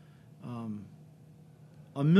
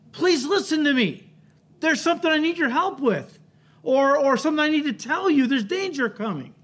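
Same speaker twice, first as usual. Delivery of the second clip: higher, pleading call for someone to listen; urgent cry for help; 8 semitones higher than usual. Retold or imitated. Someone in trouble begging to be heard, higher and urgent. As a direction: cry out as if danger is near. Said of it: "it means something's going on that".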